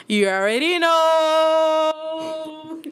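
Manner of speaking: loud, high, long-drawn cheer; hyped-up holler. Real, not acted. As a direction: stretch the last word into a long held cry. Hyped shout-out about favourite performer.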